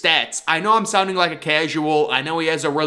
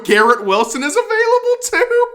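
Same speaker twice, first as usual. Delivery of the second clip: high, loud excited shout of surprise; can't believe your luck; amazed disbelief; 4 dB louder than usual. Real, not acted. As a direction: voice shooting up on too. Spots top player still available.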